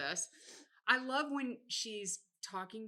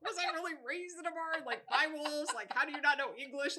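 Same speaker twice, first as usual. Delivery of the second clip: high-pitched mock exasperation; acted incredulous complaint at mother; indignant retold rant; agitated; 6 semitones higher than usual. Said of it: "I get so a-, agitated".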